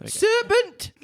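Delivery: high shrieking pigeon voice; screaming pigeon. A narrator's reading. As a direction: shriek it like an outraged bird. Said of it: "screamed the pigeon".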